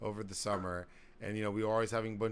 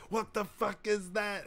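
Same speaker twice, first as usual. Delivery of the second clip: high, drawn-out mock outburst; slow, high-pitched retold yell; imitated yelling dad; 11 semitones higher, slower than usual. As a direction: imitate a dad erupting in disbelief. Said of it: "Dutch just starts yelling at both of us".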